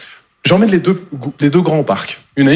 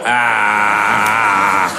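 put-on drops low and slow, a dopey kid mumbling; mock dim kid voice; 6 semitones lower, much slower than usual. Comedian mimicking a dopey child asking for chocolate. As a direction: ham up the slow sandwich-eating kid.

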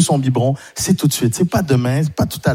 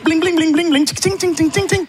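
high mouth sound effects of coins; bling ting noises, high; high imitated jingle; 11 semitones higher than usual. Shown as a demonstration. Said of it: "faire des bruitages".

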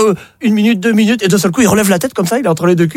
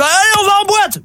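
much higher rowdy call; rugby lads calling out. Acted. The rugby lads call everyone off to the club, much higher. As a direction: holler like the team heading out.

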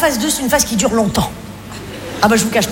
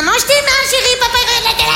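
mock sweet mother; 13 semitones higher than usual. Wife mock-talking to daughter.

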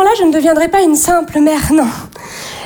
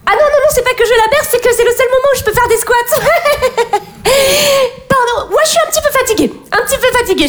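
higher-pitched, overtired sister justifying herself; frazzled young mother; 5 semitones higher, faster than usual. Acted. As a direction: play the exhausted mum, voice pitched up. Acts sister frazzled with a baby.

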